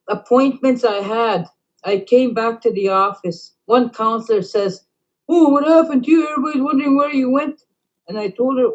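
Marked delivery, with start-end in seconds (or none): 5.3-7.6 quick, higher-pitched prying question; nosy coworker. Retold or imitated. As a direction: mimic the nosy colleague, rushed and high. Counselor quoted prying at her.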